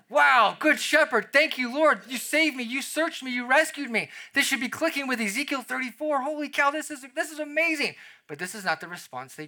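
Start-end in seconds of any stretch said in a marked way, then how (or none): 0.1-7.9 hallelujah reaction. Pretend. Believer finally gets the good shepherd, overjoyed praise. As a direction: burst out in amazed thanks to God. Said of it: "this should result in hallelujah chorus".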